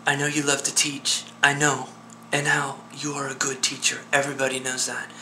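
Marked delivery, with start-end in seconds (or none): 2.3-5.1 soft reassurance. Acted. Reassuring a despairing friend.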